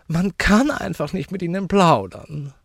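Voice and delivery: high, loud lady's complaint; huffy widow. Acted. Widow reproaching the uncle, high and huffy. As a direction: complain loudly in a high, offended voice.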